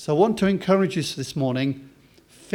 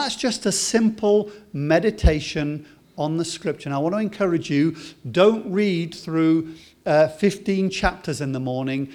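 ordinary talk

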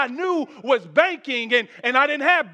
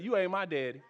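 much softer, defiant comeback; sassy translation; 4 semitones lower, 8 dB quieter, faster than usual. Retold or imitated. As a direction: sass it low like a rebellious kid. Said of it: "let me translate".

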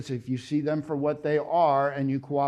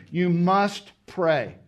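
You must pray, with must drawn out slow; slow, weighty must; much slower than usual. Shown as a demonstration. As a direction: stretch out must. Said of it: "just to wake you up".

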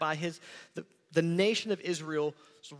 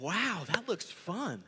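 slow, drawn-out acted wow; mock-envious admiration, stretched out; wistful envy; 4 semitones higher, slower than usual. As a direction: marvel slowly at what you missed. Acted envy at seeing the photos.

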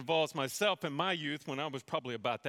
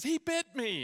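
much higher-pitched tattling wail, only a little louder; mock scream; 10 semitones higher, 3 dB louder, slower than usual. Bitten brother telling dad on his sibling, mock scream. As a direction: imitate a bitten brother's outcry. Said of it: "my brother looks up screaming and he says".

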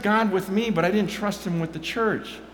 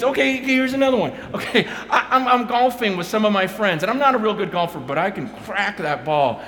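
ordinary talk

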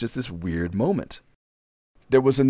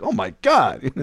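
louder, hammed-up horrified exclamation; mock horror; 3 semitones higher, 4 dB louder, slower than usual. Woman reacting to the flying mess, mock horror. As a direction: imitate her moment of horror. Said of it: "she got a look of horror".

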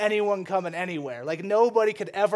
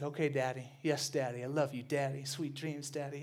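put-on softer, lower little-boy voice; sweet toddler voice; 6 semitones lower, 11 dB quieter than usual. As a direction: play the obedient toddler, sweetly. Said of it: "He's like".

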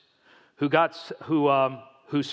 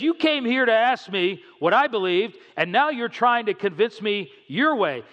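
acting out a heated accusation, higher-pitched; pitched-up mock outburst, hard on the key words; retold angry yell; 6 semitones higher, 4 dB louder than usual. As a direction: mimic a man fed up and raising his voice. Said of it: "he yells out real loud".

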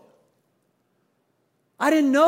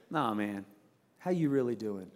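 much lower and soft, concerned; low serious friend; 10 semitones lower, 9 dB quieter than usual. Retold or imitated. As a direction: lean in and ask gently.

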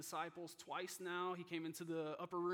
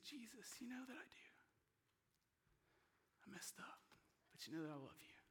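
soft, higher, near-whispered plea; hushed confession; 6 semitones higher, 9 dB quieter than usual. Acted. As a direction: answer quietly, with halting, pained sincerity. Peter answering Jesus, acted tenderly.

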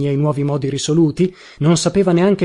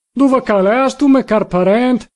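high, drawn-out call in Turin dialect; thick Turinese shout; 7 semitones higher, 4 dB louder, slower than usual. A narrator's reading. Drunken visitor calling for his dear relative, shouting in Turinese. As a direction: holler it slowly with a strong Turinese accent. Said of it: "si mise a gridare con stretto accento torinese".

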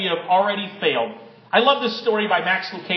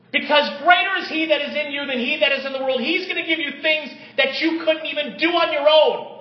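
full-force preaching; 6 semitones higher than usual. Acted. Pastor rebuking a cliché to his church, fiery preaching. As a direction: lift the room, pound it home.

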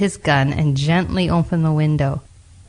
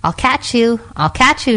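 higher-pitched call; playful calling out; 6 semitones higher than usual. A narrator's reading. Little girl chasing something in the woods, calling out. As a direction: call out in a high voice. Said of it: "she called".

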